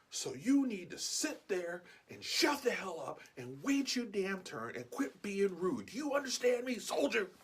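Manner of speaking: high, slow, emphatic dressing-down; stern telling-off. Retold or imitated. Shopper dressing down the rude old veteran, stern sergeant's scolding.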